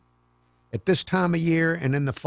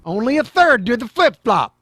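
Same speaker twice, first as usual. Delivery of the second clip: higher and louder wry echo; mock echo; 7 semitones higher, 6 dB louder than usual. Host ribbing the news clip, wry mock echo. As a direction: poke fun at the figure. Host mocking report aside.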